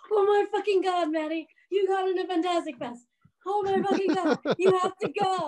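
high, loud, overjoyed squeals; imitating a friend's thrilled outburst; freaking out. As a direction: gush like a friend who can't believe the news. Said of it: "freaking out".

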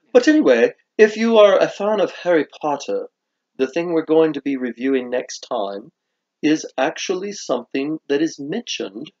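put-on British accent, swooping intonation. Host teases a Harry Potter candy, put-on British accent. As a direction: play the proper British presenter. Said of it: "how they would mock my British accent".

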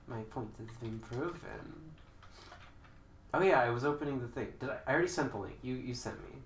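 ordinary delivery

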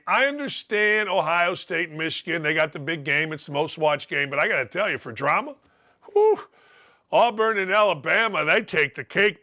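usual speaking voice

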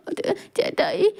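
a small, frightened high voice on ചേട്ടായി; scared child. A narrator's reading. Frightened boy starting to tell his big brother, high and soft. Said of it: "അവൻ പേടിച്ച് കരയുന്നുണ്ടായിരുന്നു".

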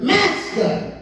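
a high, urgent cry of "Master."; frightened plea. Retold or imitated. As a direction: cry out "Master." as if the boat is sinking. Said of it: "I don't think that's the way they said it like that".

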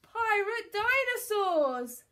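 high, slow, dawning dread; pause, then wail the reveal; drawn-out cry of alarm; horrified. A narrator's reading. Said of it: "he stammered".

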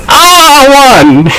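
high, stretched-out cheer; elated victory cry. Real, not acted. Gamer celebrating a win over Superman, elated.

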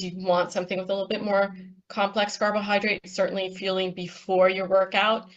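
ordinary delivery

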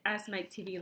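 plain reading voice

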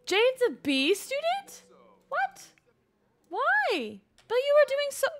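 high loud disbelieving squeak; shocked disbelief. Real, not acted. Reacting to her sim's bad grade, a high shocked squeal. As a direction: jump up in pitch, can't believe it.

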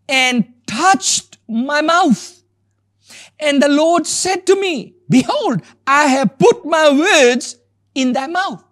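high, slow, ringing proclamation; scripture reading. Acted. Preacher reads how the Lord touched the prophet's mouth, proclaiming. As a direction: declaim it phrase by phrase with weighty pauses.

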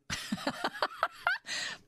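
high burst of laughter at her own answer; amused laugh. Real, not acted. Host laughing at her own wolves punchline, high and light. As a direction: let a laugh break out after the joke.

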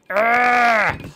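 high, loud growling yell; playful straining battle roar; effort roar. Pretend. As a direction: roar as you heave at a wall. Straining to break the building.

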